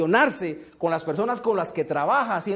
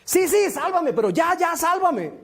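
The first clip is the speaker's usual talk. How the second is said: desperate pleading; 8 semitones higher than usual. Retold or imitated. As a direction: beg for your life while dangling. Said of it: "el tipo llega y dice". (súplica desesperada, aguda y fuerte)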